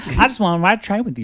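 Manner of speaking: mock country accent. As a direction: tease it out like a country girlfriend. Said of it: "I'm doing a country accent for her".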